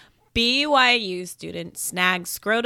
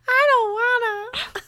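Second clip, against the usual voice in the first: whiny cry; 14 semitones higher, 5 dB louder than usual. Real, not acted.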